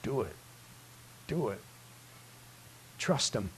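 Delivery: soft, low inner voice; still small voice. Acted. The quiet inner voice urging the beggar, soft. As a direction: murmur it like a gentle nudge. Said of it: "that still small voice going".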